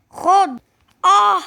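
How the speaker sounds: mock beating cry; hit and scream. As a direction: scream as the blow lands. Bully hits, kid screams.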